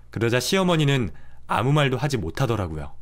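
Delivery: usual speaking voice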